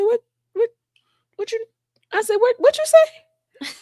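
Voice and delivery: very high-pitched retold disbelief; mock-stunned, voice shooting way up; baffled. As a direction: squeak out a stunned double-take. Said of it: "I was baffled".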